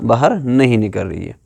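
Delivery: ordinary talk, nothing marked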